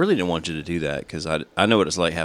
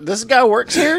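mock onlooker; 5 dB louder, slower than usual. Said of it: "some guy just looking at you going".

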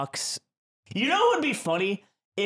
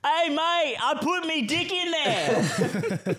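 put-on Australian accent; 13 semitones higher, slower than usual. Mimicking an Australian zoo worker to the guys, in a hammed-up Australian accent. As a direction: ham up a cheeky Australian zookeeper accent. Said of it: "I wish I could do the accent so bad".

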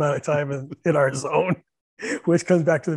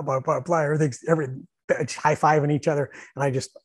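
ordinary delivery

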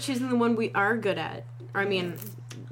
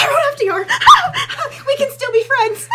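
loud high laughing relief; laughing shout; 12 dB louder than usual. Real, not acted. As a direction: shriek it through laughter. Relieved shouting laugh at right answer.

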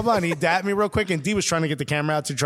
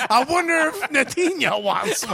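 high-pitched, laughing mock-fan line; teasing quote pitched up with laughter; amused mock quote; 7 semitones higher than usual. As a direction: voice the gushing fan through laughter.

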